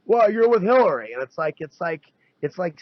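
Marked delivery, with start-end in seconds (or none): 0.1-1.1 higher, louder mocking voice of a confused supporter; mocking a Trump supporter. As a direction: sneer it like a baffled Trump fan.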